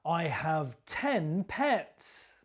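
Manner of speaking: slow, "ten" stressed; slow and deliberate, leaning on "ten"; slow model sentence. Shown as a demonstration. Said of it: "copy my stress and my intonation".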